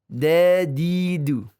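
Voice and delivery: "Dadidu" drawn out slowly with a light thin d; thin Quranic dal. Shown as a demonstration. Teacher models the Quranic dal, slow and thin. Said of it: "Pelafadan Quran itu dadidu".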